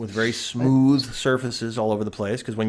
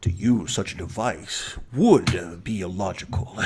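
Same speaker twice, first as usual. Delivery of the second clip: deep mock-Klingon solemnity, odd stresses; Michael Dorn; 6 semitones lower, 4 dB quieter than usual. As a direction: lean on "would" as if it mattered most. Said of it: "stresses the wrong words in every sentence".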